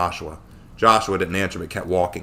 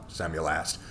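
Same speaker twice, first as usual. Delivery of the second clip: usual speaking voice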